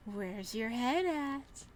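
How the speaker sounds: dragged-out, menacing killer taunt; slow creepy taunt. Acted. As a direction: tease her slowly like a mad killer. Killer mocks with severed head.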